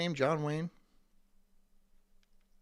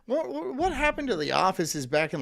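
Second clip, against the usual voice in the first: ordinary talk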